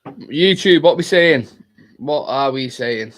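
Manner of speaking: loud hype greeting to the chat; hyped streamer call-out. Real, not acted. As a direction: shout to the viewers. Calling out to the chat.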